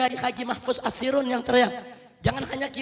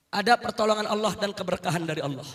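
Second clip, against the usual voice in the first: ordinary talk, nothing marked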